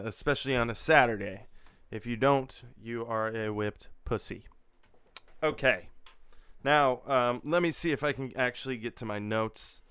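ordinary talk, nothing marked

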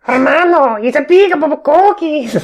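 put-on high, loud, hammy Mexican accent. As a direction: shout the slogan in a Mexican accent. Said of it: "start saying that in a Mexican accent".

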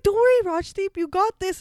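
high, loud pep talk; acting out a confident boss; imitated breezy reassurance. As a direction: brush off the worry briskly. Imitates boss brushing off worries.

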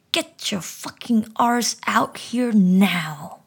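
slow, loud, raging shout; a father's enraged roar across the garden; furious yell. Acted. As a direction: bellow it at the hiding child. Father hunting boy in garden.

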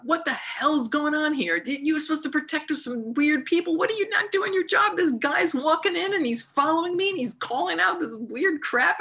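high-pitched retold rant at the guard; indignant scolding. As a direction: tell off the useless guard. Confronting the security guard.

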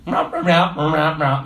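wordless wah-wah droning; Peanuts teacher. Acted. What kids hear the teacher say, cartoon wah-wah. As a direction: imitate muffled, meaningless grown-up speech. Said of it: "There's no words".

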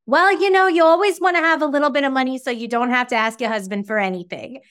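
put-on thick Long Island grandma accent, high and quick; Selma's Long Island accent. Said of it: "I'm gonna do an impression of her, but, like, very thick Long Island accent".

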